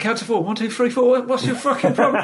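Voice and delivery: high, loud mock outrage; high-pitched acted scolding; exasperated girlfriend. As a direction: imitate a fed-up girlfriend at the top of your range.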